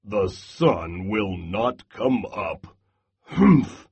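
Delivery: grump it out, then huff; grumbling Bear. Acted. Bear insists all night, low and huffy.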